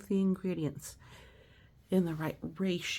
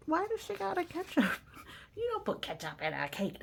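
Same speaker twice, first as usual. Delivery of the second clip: much higher, fast, disbelieving; amazed; 12 semitones higher, 4 dB quieter than usual. Real, not acted. Teacher to kids, squealing in disbelief at the ketchup. Ketchup in cake shocks reader.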